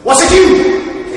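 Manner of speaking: high, loud, slow demand; demanding genie. Shown as a demonstration. Drama teacher showing the genie questioning someone. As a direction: bear down on them slowly. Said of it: "which affect is it".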